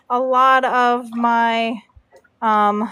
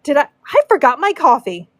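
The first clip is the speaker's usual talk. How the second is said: ordinary talk, nothing marked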